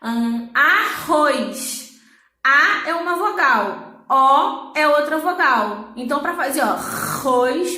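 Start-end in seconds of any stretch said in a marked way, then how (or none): 0.5-1.9 "arroz" drawn out slowly, guttural R in the middle; throaty double R. Shown as a demonstration. Teacher gives an example, "arroz" slow with throaty R. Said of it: "pra ele vir da garganta". 6.7-7.8 "arroz" slowly, with a long guttural R; throaty double R. Shown as a demonstration. Teacher shows why R doubles, "arroz" with throaty R. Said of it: "pra ele vir da garganta".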